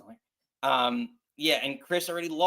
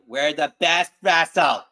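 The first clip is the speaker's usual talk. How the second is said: slow, louder mock Boston accent; 8 dB louder, slower than usual. Host imitates the wrestler's Boston-accented promo, slow and loud. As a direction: drag out wrestle like a Boston catchphrase. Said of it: "She says it weird with a weird, like, Boston accent".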